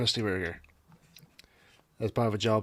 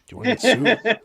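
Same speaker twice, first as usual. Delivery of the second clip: laughing mock warning; 11 semitones higher, 8 dB louder than usual.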